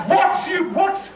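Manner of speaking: high, loud incredulous yelp; shocked outburst. Acted. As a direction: blurt it in disbelief. Alfred shocked at tights.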